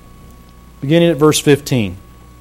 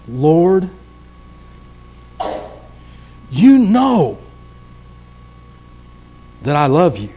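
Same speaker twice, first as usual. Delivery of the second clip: a touch of grief, "you know" cried out higher; grieved Peter; 3 semitones higher, slower than usual. Acted. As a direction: plead it with a catch of sorrow. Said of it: "Peter was grieved".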